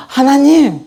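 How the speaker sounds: high, loud call to God; excited cry. Retold or imitated. Adam thanking God for Eve, excited cry.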